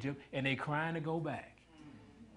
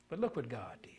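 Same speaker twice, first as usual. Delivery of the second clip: whisper; 3 semitones lower, 8 dB quieter than usual. Acted. Hushed turn in sermon.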